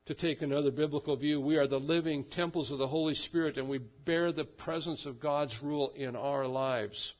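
rousing pulpit delivery; fervent preaching. Acted. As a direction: drive the point home to the congregation. Preacher rising to emphatic point.

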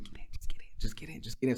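mostly whispered, repeated urging; urgent hushed order. Retold or imitated. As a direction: whisper to hurry them aboard. Retold hushed order from the truck.

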